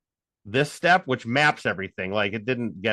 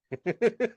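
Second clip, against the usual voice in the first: short high laugh; amused burst of laughter; 9 semitones higher than usual. Real, not acted. Caller cracking up at his prank on the bot, short laugh.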